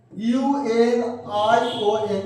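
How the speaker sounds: slow letter-by-letter spelling; spelled out letter by letter. Shown as a demonstration. Teacher slowly spelling the word for pupils. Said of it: "union".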